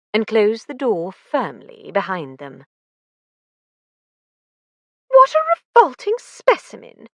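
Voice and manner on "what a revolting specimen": high, loud disgust. A narrator's reading. Lucy disgusted at the arrested killer, high and loud. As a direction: spit out the revulsion. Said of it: "said Lucy in disgust".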